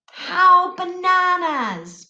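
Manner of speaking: slow, high, silly "How bananas"; mock exclamation. Teacher hamming up a wrong example for the kids.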